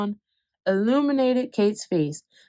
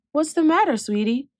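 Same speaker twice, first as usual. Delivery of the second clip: tender mom; 7 semitones higher than usual. Acted. Mom comforts sad son.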